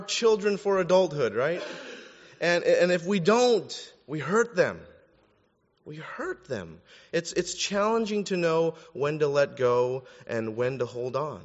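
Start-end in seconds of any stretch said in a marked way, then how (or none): 5.9-6.8 hushed repetition. Real, not acted. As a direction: drop to a soft, grave near-murmur. Repeats warning much more softly.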